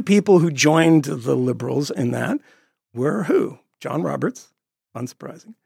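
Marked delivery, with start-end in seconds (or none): none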